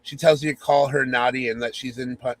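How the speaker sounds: ordinary talk, nothing marked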